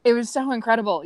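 ordinary talk, nothing marked

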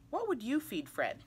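normal talking voice

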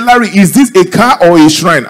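high, loud disbelief; incredulous question. Acted. Pastor asked to pour anointing oil on car, incredulous. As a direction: exclaim it in mock disbelief.

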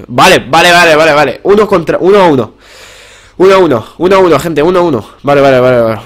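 loud, high triumphant repeats; thrilled gamer shouting; elated. Real, not acted. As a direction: celebrate levelling the score. Wins round, score levelled.

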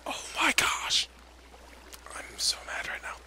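angry whisper, held down; whispering fury so nobody wakes; frustrated whisper. Real, not acted. Gamer whispering fury so the house stays asleep. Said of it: "everybody in my house wasn't asleep".

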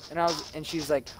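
ordinary talk, nothing marked

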